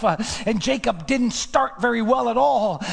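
building to high, urgent delivery; rising preaching fervor. Real, not acted. Preacher telling Jacob's turning decision, mounting fervor. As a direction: drive the story upward with mounting force. Preaching climbing to full force.

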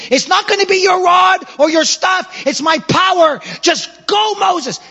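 high, loud commanding call; booming divine encouragement, lifted high; God calling Moses. Acted. Preacher voicing God calling Moses.

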